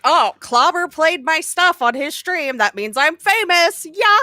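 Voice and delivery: high, smug mock-boast; lightly voiced smug show-off; mocking the streamer. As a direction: puff yourself up like a proud newbie streamer. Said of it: "then he had to be like".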